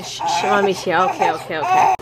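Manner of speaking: ordinary delivery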